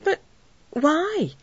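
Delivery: rising, puzzled question; a startled, high why; bewildered. Acted. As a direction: ask it baffled, pitch jumping up.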